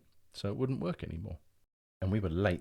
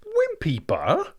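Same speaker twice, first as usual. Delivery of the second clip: much higher, slow, scornful disbelief; incredulous cry; 9 dB louder, much slower than usual. Acted. Brian scoffing at his brother, incredulous cry. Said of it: "cried Brian".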